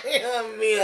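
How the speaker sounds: high burst of real laughter; cracking up while telling it; laughing. Real, not acted. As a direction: laugh through the words. Laughing at his own story.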